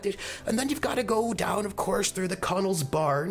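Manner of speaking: put-on high, chatty elderly woman giving directions; a rambling old lady in her eighties, pitched high; old village woman. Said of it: "she says".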